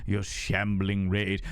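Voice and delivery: slow, drawn-out low pitch; playful low repeat. Pretend. Streamer teasing raiders, playful low repeat. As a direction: drop low and stretch out RAID.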